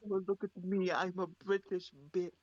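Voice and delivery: hammed-up British accent; mock posh British accent; fake British accent. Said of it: "a fake British accent".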